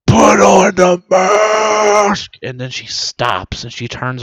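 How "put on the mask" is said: loud slow bellow; shout. Retold or imitated. A stranger yelling at the maskless mom, shouting. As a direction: yell it across the store. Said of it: "someone goes".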